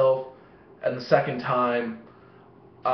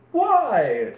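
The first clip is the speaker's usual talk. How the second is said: retold outburst; 8 semitones higher than usual. Acted exclamation in retold fight.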